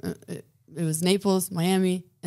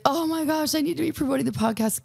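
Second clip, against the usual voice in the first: higher, quick flustered realization; retold "oh my gosh" panic, pitched up; self-reproach; 8 semitones higher than usual. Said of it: "but I was like".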